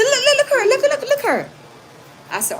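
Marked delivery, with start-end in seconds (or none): 0.0-1.5 high, louder tattling voice with laughter; mimicking the man. The man pointing her out to the cops, high squeal. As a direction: squeal it like he's telling on her. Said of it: "So he said".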